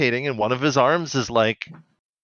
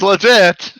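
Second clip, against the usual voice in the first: sarcastic; 8 semitones higher, 8 dB louder than usual. Retold or imitated. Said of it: "It was like".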